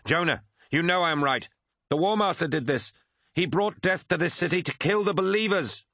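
high, urgent accusation; narrated shout. A narrator's reading. Crewman appealing to Jonah against the War Master, shouted high. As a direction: plead the case urgently, pitch way up. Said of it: "shouted Kassar".

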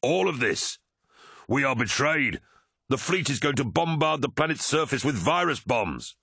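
high, pressing, half-shouted warning; urgent shout. A narrator's reading. Pilot warning his brother of the bombardment, shouting. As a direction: drive the warning home at high pitch. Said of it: "shouted Tarvitz".

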